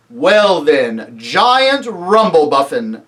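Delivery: loud, measured lion's address; commanding lion. Acted. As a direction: address the giant loudly and firmly. Lion addresses the giant by name.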